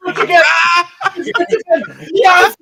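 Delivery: high, loud whooping celebration; triumphant king. Acted. King celebrating his winnings, shouting high and loud. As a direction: cheer like your ship came in.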